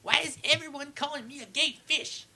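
high, put-on [name] voice; South Park [name] voice. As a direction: play it like the cartoon [name]. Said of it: "I'm trying to do the one from South Park".

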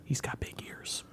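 whispering. Acted. Warning secretly about Papa's ears.

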